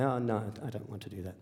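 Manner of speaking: very soft, low murmur; reluctant refusal. Retold or imitated. Son refusing dad's hunting invitation again, low and very soft. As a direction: back out very quietly, voice dropped low.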